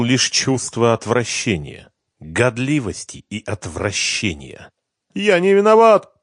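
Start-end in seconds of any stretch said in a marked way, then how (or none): 5.1-6.2 high, loud tearful wail; desperate high-pitched crying plea. A narrator's reading. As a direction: wail it as if in tears. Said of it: "плакал Семён".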